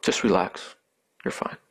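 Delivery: low, soft, soothing pastor; calm reassurance. Retold or imitated. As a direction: quietly settle someone who is shaken. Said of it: "the pastor was so calm".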